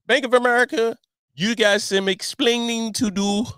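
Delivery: hammed-up sitcom scold; Ricky Ricardo. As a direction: call out the bank like a mock-scolding sitcom husband. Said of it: "that was a bad, Ricky Ricardo impression".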